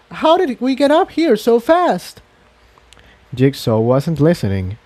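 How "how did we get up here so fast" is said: high bewildered character voice; high pony voice. Acted. Tiptoe asking how they climbed so fast, bewildered. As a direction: ask it in wonder.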